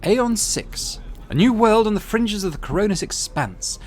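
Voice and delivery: high, cold commanding woman's voice; imperious rogue trader. Acted. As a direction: lay out the test like a superior.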